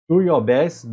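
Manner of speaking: ordinary talk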